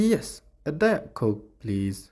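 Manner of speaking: slow "Yes, a Diet Coke", t of "Diet" dropped; cut-off Diet. Shown as a demonstration. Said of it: "ይ ቁርፅ ተብላ".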